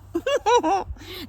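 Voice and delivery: high, giggly laugh; can't help laughing at the picture; high burst of laughter. Real, not acted. Laughing at a Thor vision.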